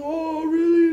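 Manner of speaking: high, loud gushing mother's voice; imitated delighted surprise. Mother learns of two granddaughters, imitated delight.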